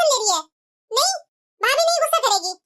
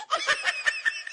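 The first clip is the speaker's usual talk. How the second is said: sudden high burst of laughter; 9 dB quieter than usual. Acted. Mother-in-law laughing with neighbour.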